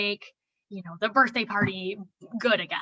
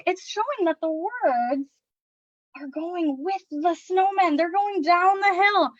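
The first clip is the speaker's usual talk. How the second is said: bright, slower storytime voice pitched higher; excited read-aloud; 7 semitones higher, 4 dB louder, slower than usual. Shown as a demonstration. Parent reading a snowman book to little kids, excited storytime voice. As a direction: read it like you're thrilled for the kids.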